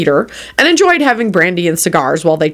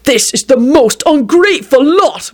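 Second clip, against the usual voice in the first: slow, raised-pitch indignant pronouncement; indignant doctor; 7 semitones higher than usual. A narrator's reading. Doctor abandoned by his patient, slow indignation. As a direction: huff each word out slowly, affronted. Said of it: "began Doctor O'Malley".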